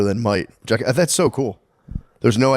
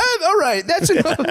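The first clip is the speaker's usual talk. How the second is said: much higher, flustered mock teacher. Teacher cuts off the students' sex question, flustered. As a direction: cut it off like an embarrassed teacher, hammed up. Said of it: "And he'd be like".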